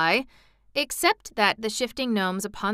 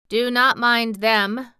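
slow, deliberate machine man, slightly louder; lightly voiced talking machine, words slowly measured out; machine character; 3 semitones higher, 6 dB louder, slower than usual. A narrator's reading. Tick-Tock calming Dorothy as she shrinks back, slow and deliberate. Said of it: "replied the machine".

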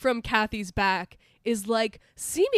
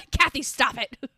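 raised, urgent mock shout of her name; mock yell; 3 semitones higher, 6 dB louder than usual. Friends yelling at a turning demon, mock shout.

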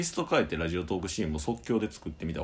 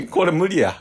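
laughing; 8 semitones higher, 11 dB louder than usual. Real, not acted. Laughing giving up.

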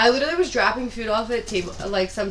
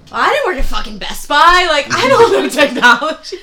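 louder, half-laughing exasperation; mock outrage at a pushy customer; exasperated retort; 6 semitones higher, 9 dB louder than usual. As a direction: throw it back at him, fed up and laughing. Retelling frustrated reply to customer.

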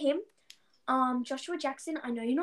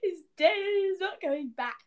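high, hammed-up gasp of grief; mock despair; 8 semitones higher than usual. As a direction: overplay the shock of a character's death. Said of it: "I was like".

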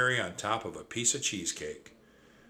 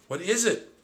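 high, louder alarmed squeak; squeaky; 10 semitones higher, 5 dB louder than usual. Acted. Siblings rush to Trap, high squeak. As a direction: squeak out in worry. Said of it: "we squeaked together".

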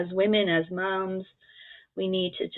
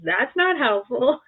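louder, slow, fed-up comeback; exasperated retort; 5 semitones higher, 5 dB louder, slower than usual. Retold or imitated. Mom answering unhelpful family, slow exasperated retort. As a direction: let the annoyance drag out each word. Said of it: "And I'm like".

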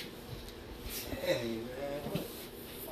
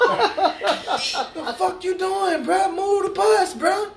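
high, loud holler breaking into laughter; loud, laughing mock outrage; exasperated yelling; 12 semitones higher, 6 dB louder, much slower than usual. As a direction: yell at a driver to get moving. Acted yelling at a stalled driver.

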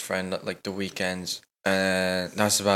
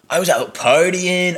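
mock boast; 7 semitones higher, 6 dB louder, slower than usual.

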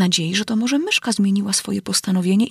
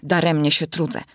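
ordinary delivery

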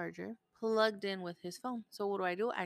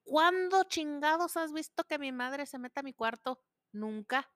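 higher, louder scolding; mock furious mom; retold angry yell; 6 semitones higher, 6 dB louder than usual. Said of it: "I said".

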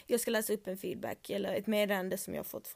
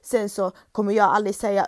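ordinary talk

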